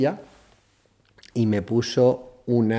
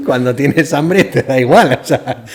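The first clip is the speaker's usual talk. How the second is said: real laughter spilling into louder words; laughing; 3 semitones higher, 10 dB louder, slower than usual. Real, not acted. The storyteller cracks up at his own sandwich trick, laughing loudly. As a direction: laugh through the line.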